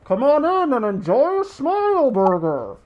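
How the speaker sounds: high, cheery voice muffled by a costume head; muffled mascot voice. Acted. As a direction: sing it out from inside a mascot head. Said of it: "a muffled voice underneath the big plush head".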